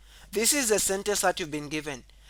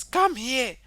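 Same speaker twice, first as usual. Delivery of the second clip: raised, higher call; shouting; 8 semitones higher, 7 dB louder than usual. Shown as a demonstration. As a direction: call it out like an order. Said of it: "So if Mwansa was shouting".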